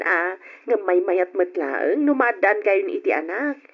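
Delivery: normal talking voice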